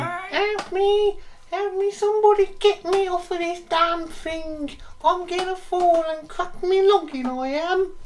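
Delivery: put-on very high, loud, slow old English falsetto; shrill falsetto. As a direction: squeal like a panicking old Englishman. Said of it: "to a shrill".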